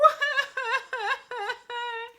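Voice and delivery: giggling in falsetto; high laugh. Real, not acted. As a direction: laugh at your own act. Laughs after fake crying.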